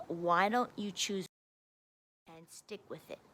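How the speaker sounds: normal talking voice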